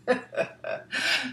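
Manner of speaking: bright high laugh at the photo; real laugh. Real, not acted. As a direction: laugh at the funny picture. Funny sock photo.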